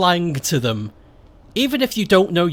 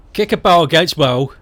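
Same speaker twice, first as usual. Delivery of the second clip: broad Stoke slang, "barrel"; Stoke dialect; 4 dB louder than usual. Shown as a demonstration. Teaching a Stoke phrase in full dialect. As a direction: say it like a local lad. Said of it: "I will kick this ball".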